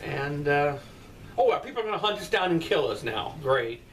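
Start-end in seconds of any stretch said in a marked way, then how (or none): none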